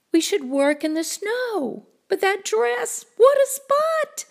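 put-on high, rising worried girl voice; dismayed little-girl voice; fretting child. Sally frets over stained dress.

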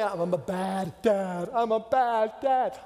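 slow, drawn-out mock lament; guilty wail. Dad watching his kids swim away, wailing in guilt.

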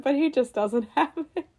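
breaking into laughter; laughing. Real, not acted. Host on actor's hopeless Boston accent, laughing. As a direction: laugh through the verdict.

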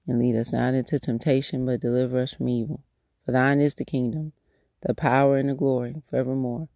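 soft, unhurried prayer voice; spoken prayer. Acted. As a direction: pray it quietly and earnestly, lifting each phrase to God. Recites the Lord's Prayer.

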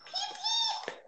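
tiny high bird chirp; high squeaky peeping. Acted. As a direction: peep it very high, giggling. Bird sound for toddler.

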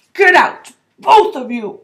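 loud, strained order; uncle's loud croak. A narrator's reading. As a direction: croak it out forcefully. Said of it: "croaked Uncle Vernon".

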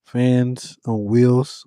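slow, drawn-out words; slow emphasis. Real, not acted. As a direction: spell out the ridiculous detail slowly. Stressing the absurd fans.

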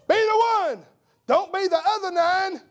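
high, loud preacher's shout; preaching at full force. Acted. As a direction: thunder it from the pulpit. Preaching exhortation peak.

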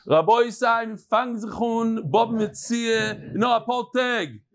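high, slow announcer pitch; Yiddish promo. Acted. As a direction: proclaim it to the camera, drawing out each phrase.

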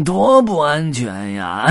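slow, high, drawn-out character voice; loud, teasing hobo voice; mock-concerned tramp. A narrator's reading. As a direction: draw it out with false concern. Tramp mocks the captured piglet.

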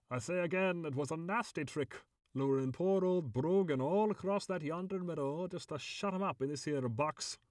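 high, indignant leprechaun complaint; gruff leprechaun. A narrator's reading. Caged leprechaun protests being lured into a box, gruff. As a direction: grumble as a wronged, gruff little creature. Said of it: "in a surprisingly gruff voice".

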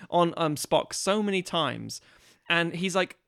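usual speaking voice

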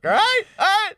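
high, loud, excited squeal. Real, not acted. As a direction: squeal it with glee. Excited end of the pitch.

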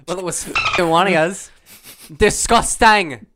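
loud, high, hammed-up disgust; put-on outraged rant. Host accusing the household in a bit, loud mock outrage. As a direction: build to a big drawn-out mock outburst.

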